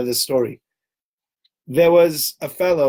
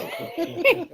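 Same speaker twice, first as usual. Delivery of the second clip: a high laugh bursting out; high burst of laughter; 4 dB quieter than usual. Real, not acted. The rabbi cracking up at his own story, high laugh.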